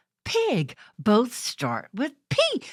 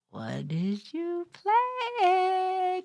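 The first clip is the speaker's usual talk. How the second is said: usual speaking voice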